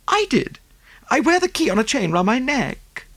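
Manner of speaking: high, breathless socialite voice; gushing rich American lady. Acted. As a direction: pour it out like a fussy rich lady. Mrs Oppleson voiced.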